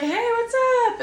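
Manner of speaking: mock-breezy greeting to cover up the hurt; overly cheery greeting. Greeting people never met after a bad fall, overly cheery.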